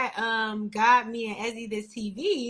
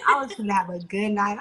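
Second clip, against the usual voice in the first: usual speaking voice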